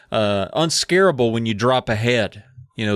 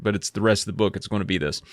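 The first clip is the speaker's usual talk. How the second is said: usual speaking voice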